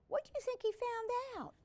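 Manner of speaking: suspense question. Real, not acted.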